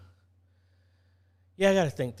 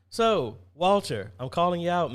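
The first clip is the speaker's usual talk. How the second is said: loud call-out; 6 semitones higher, 8 dB louder than usual. Real, not acted. Calling out a viewer by name.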